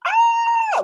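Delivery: very high playful shriek; mock scream. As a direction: shriek like you saw a ghost. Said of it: "but you're like".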